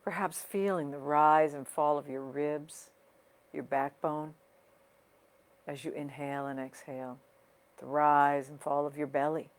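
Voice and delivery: unhurried guiding voice with long pauses, softening toward the end; guided meditation. Real, not acted. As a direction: ease listeners into their breathing, leaving space between phrases. Leads a breathing meditation.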